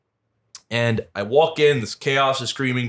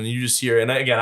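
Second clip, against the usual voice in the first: normal talking voice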